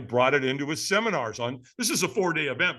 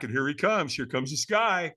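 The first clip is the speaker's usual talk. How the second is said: ordinary talk, nothing marked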